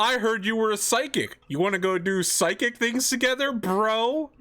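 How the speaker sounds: mock a pushy bro invitation. Teasing voice, mocking a bro invite.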